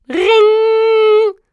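high, loud drawn-out "riiing"; doorbell ring imitation. A narrator's reading. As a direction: imitate an insistent doorbell on one high note. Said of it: "De deurbel". (luid, hoog nagedaan deurbelgerinkel)

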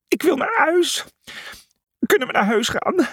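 high, whimpering plea; whiny scared voice. Acted. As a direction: beg to go home. Frightened animal begs to leave.

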